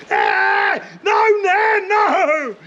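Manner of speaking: high, loud pleading yell; imitating a child begging his nan to stop; brother screaming. Boy screaming at his nan during a beating, high pleading yell. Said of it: "I could hear him screaming".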